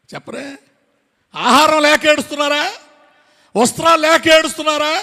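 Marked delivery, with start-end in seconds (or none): 1.3-4.9 high, loud rhetorical questions; full-force preaching. Real, not acted. Preacher challenges the congregation about their weeping, full-force preaching. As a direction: fire off each question in the same ringing pattern.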